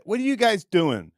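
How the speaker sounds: ordinary talk, nothing marked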